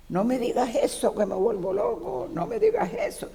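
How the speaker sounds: a priest begging her to stop, voice climbing; anguished priest. Retold or imitated. Priest begging the widow not to say it, anguished high plea. Priest pleading in retold confrontation.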